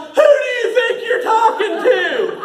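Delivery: very high, outraged yell; indignant shout. Retold or imitated. In-character son talking back to mom, indignant shouting. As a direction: shriek back as if deeply insulted.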